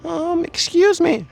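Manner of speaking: high, slow, timid "Um, excuse me."; meek high voice asking for attention; timid approach. Shown as a demonstration. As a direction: shrink into it, too shy to ask. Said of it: "You don't just".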